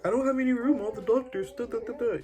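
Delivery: mocking the husband's excuse, high and whiny. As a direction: parody his lame excuse.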